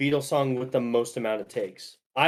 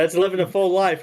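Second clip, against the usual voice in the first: mock upbeat; 5 semitones higher, 5 dB louder than usual. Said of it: "I said".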